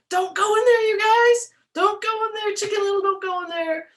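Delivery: high, loud pleading warning; warning cry. Pretend. Reader calling to the birds not to enter.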